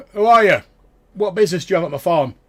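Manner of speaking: high, loud challenge from a suspicious farmer; wary farmer. Acted. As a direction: demand it like a farmer guarding his land.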